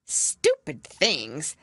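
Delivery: a slow, indignant outburst. A narrator's reading. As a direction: drag out the scorn, indignant. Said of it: "Alice began in a loud, indignant voice".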